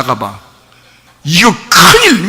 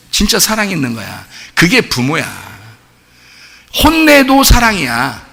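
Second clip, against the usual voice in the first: ordinary delivery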